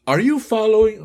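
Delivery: a first, not female enough try at Raquel; Raquel's voice. Acted. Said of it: "viene Raquel ahora y dice".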